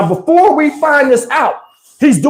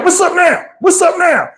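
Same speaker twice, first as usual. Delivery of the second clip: challenging yell, pitched up; mock shout; 6 semitones higher than usual. Mo yelling at the men who came out, challenging shout. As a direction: shout it like picking a fight.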